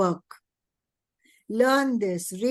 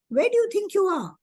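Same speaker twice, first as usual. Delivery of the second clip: put-on much higher, bright question to a small child; lilting high teacher question to a child; high coaxing question; 10 semitones higher than usual. Shown as a demonstration. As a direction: ask it up high like talking to a little kid. Teacher asks child at wheel.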